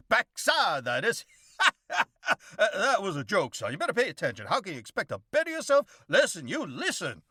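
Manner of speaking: high, loud, pompous drawling windbag; blustery Southern gentleman. Acted. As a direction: lecture the boy like a self-important old colonel. Elrod lectures Cerberus.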